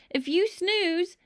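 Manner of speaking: urgent plea. A narrator's reading. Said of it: "I cried".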